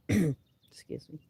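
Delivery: rough just-woken voice; froggy morning throat. Real, not acted. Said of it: "I still have my morning voice".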